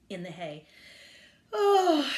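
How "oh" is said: loud, high stretching yawn. A narrator's reading. Cat woken from her nap, yawning. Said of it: "she stretched and yawned".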